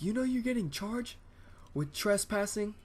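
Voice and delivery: high-pitched, incredulous dad voice; imitated upset dad. Dad phoning his kid about charges, mock high-pitched scolding. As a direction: mimic a dad breaking bad news, high and stretched. Voicing angry dad on the phone.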